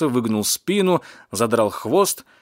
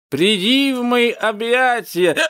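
high, drawn-out theatrical call; drunken cat's grand declaration; impassioned cry; 12 semitones higher, 4 dB louder, slower than usual. A narrator's reading. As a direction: cry it out slowly, pitched way up. Cat ends with grand cry.

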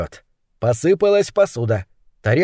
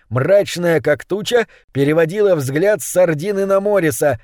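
plain reading voice

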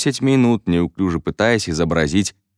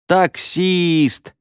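high, excited shout; shouted guess; 11 semitones higher than usual. A narrator's reading. Class guessing Мо's mime, high shout. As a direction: call out the answer all at once. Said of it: "закричали все".

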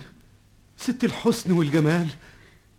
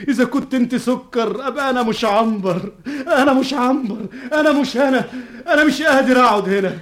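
high, loud, crying panic; rising distraught cry of confusion; panicked outburst; 7 semitones higher, 5 dB louder than usual. Acted. Panicked outburst of lost identity. (ذعر باكٍ بصوت عالٍ ومرتفع)

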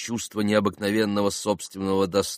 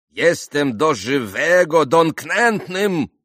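slow, raised, grandly wounded Polish; offended exclamation; 8 semitones higher, 7 dB louder, slower than usual. A narrator's reading. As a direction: declaim your hurt honour, drawing it out. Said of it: "воскликнул было он". (оскорблённое театральное восклицание)

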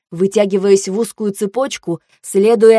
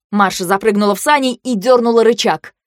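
ordinary delivery

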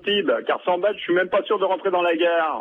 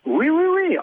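high-pitched yes; 13 semitones higher, 3 dB louder than usual. Acted. Caught lying, high protest.

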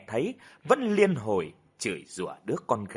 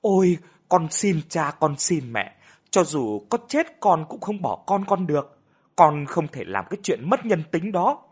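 higher, louder desperate begging; pleading; 5 semitones higher, 4 dB louder than usual. A narrator's reading. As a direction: beg the parents desperately. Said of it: "van xin". (van xin khẩn thiết)

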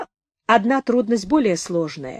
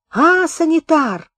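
higher, drawn-out insistent summons; demanding call; 8 semitones higher, 4 dB louder, slower than usual. A narrator's reading. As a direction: call out slowly and insistently for the orderly. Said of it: "крикнул из вагона хрипловатый, требовательный голос". (требовательный протяжный зов повыше)

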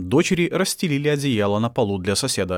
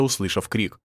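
usual speaking voice

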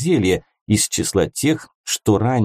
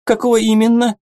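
high fairy voice; 13 semitones higher, 3 dB louder, faster than usual. A narrator's reading. Fairy turns to Freya.

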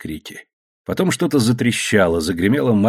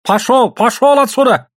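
high, loud order to get out; angry shout; 12 semitones higher, 6 dB louder than usual. Acted. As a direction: drive them off with a yell. Boss drives women away.

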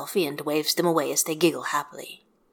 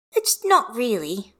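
put-on child confessing; 5 semitones higher, slower than usual. A narrator's reading. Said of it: "Hestia confesses".

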